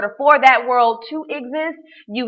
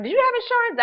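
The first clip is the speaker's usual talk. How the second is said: much higher, a mock questioning listener; skeptical listener; faster than usual. Imagined audience asking, in mock higher voice. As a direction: play the doubting audience member. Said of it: "you might be saying".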